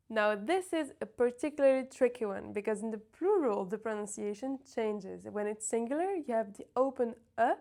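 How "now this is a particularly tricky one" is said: ordinary delivery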